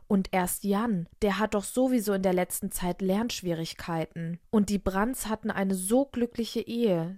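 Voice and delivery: plain reading voice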